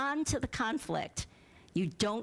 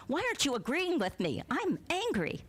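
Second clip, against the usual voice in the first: higher, quicker mock complaint; mock anger; 7 semitones higher than usual. Woman scolding Eckhart Tolle, mock angry and high. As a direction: play the angry woman, lightly. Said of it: "she's getting angry at him".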